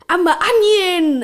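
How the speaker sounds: a hint of Shrek, drawn out; Shrek quote. Retelling the first Shrek film to listeners, lightly voiced Shrek. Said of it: "in the first Shrek ... he was like".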